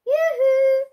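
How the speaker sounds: loud, high sing-song yoo-hoo; calling out. A narrator's reading. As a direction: call up the hill to the girl. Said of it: "Miss Franklin called".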